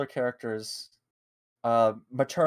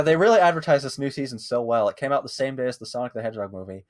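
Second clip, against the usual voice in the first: ordinary delivery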